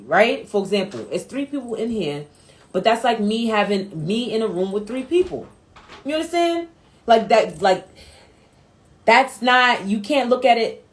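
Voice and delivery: ordinary talk, nothing marked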